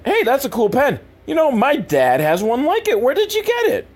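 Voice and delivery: put-on high, innocent teen; naive kid voice. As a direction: play Jimmy wide-eyed and high. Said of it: "But Jimmy's like".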